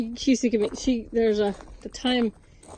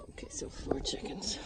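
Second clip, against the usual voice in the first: whispered count so the birds stay calm; 15 dB quieter than usual. Real, not acted. Woman whispering in the dark coop so chickens sleep. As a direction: hush it under your breath.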